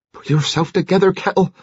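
high-pitched talking to herself; high, rattled self-reproof; flustered self-scolding. A narrator's reading. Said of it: "She lost her head slightly".

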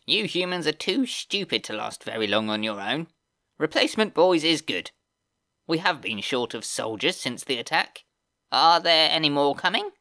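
high, whiny sneering goblin voice; screeching whiny goblin. Acted. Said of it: "with his screeching, whiny voice".

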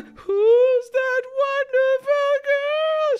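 high, very slow puppet croon; puppet impression. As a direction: draw it out like the puppet. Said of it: "and then there's".

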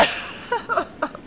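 high breathless burst of laughter; high laugh fit. Real, not acted. Gamer laughing at a glitching companion, high laugh fit. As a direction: crack up helplessly.

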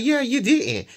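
high, loud retold comeback; mock-indignant agreement; upset retort. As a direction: act out an indignant retort, pitched well up. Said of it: "she gets very upset".

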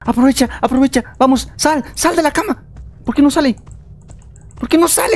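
high, hurried urging; frantic urging. Real, not acted. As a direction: push the friend to get out now. Urging friend out of bed. (apremio frenético y agudo)

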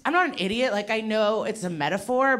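normal talking voice

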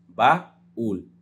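baúl pulled apart; syllables split. Shown as a demonstration. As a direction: split baúl into two beats, stress on the ú. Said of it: "se separa en dos".

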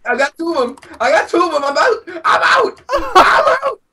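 frantic rising yell; panicking gamer. Real, not acted. Escaping with stolen sheep, yelling.

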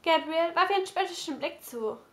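ordinary delivery, nothing marked

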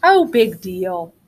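slow, lightly voiced bored boy; a yawning brother brushing off the rules. A narrator's reading. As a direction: shrug it off, unimpressed and slow. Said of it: "who gave a bored yawn".

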